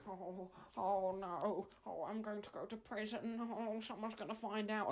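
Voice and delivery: high, trembling panicked voice; shaking Edmund. Retold or imitated. Edmund gripping the steering wheel in panic, high trembling voice. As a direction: sound terrified of prison. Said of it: "he was just shaking".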